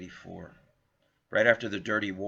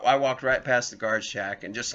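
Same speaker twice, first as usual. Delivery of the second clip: ordinary talk, nothing marked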